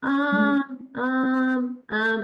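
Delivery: droning, held "um"s on a level tone; robotic speech. Shown as a demonstration. Teacher showing how students shouldn't speak, robotic monotone. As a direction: drone each "um" like a robot. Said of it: "shouldn't speak like robots".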